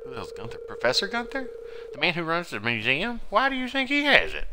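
questions climbing to a high pitch; acted incredulous questioning, rising high; doubting companion. Companion questioning the kid about Gunther, voice climbing high. Acting a game cutscene dialogue.

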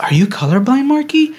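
a light impression of a concerned coworker. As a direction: imitate a worried coworker. Letty genuinely concerned.

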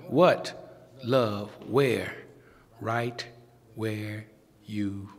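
preacher calling and answering the congregation, slow and low; preaching cadence. Acted. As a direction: draw each word out for the congregation. Sermon climax call and response.

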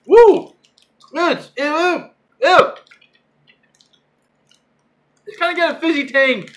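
squealing, high and loud; excited disgust. Real, not acted. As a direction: shriek at the sour taste. Tasting a sour candy.

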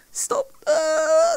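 on the edge of tears, pleading; a real scared whimper at the screen; frightened whimper. Real, not acted. Player begging the game to stop, near tears.

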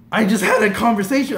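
high, loud excited disbelief; amazed. Retold or imitated. Fan after chatting with his idol, amazed. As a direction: blurt it out, thrilled.

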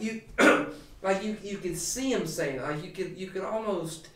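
ordinary talk

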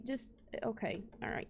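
usual speaking voice

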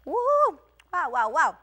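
loud wow at the first taste; delighted reaction. Real, not acted. Vlogger tasting her own curry, loud delighted wows. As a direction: let the flavor hit you.

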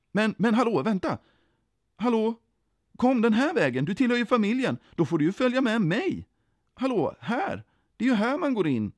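high, lively, insistent calling; urgent calling. A narrator's reading. Jesus ropar vänta, den här vägen, urgent. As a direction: call them back and wave them in.

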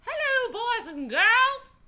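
put-on Southern accent, like a friendly storyteller; Harriet Tubman, Southern accent. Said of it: "say that she had a Southern accent".

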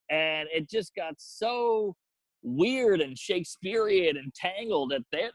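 ordinary delivery

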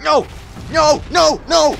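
high, loud repeated cries; sharp bursts of gamer dismay; shouted protest. Real, not acted. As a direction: yell each word as its own burst. Things go wrong in game.

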